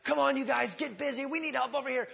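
hammed-up urging to get busy; pushy call to action. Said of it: "You'll never find us being like".

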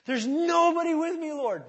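high, loud exaggerated wail to the Lord; mock despairing David. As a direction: parody David's self-pity. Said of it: "let me correct David".

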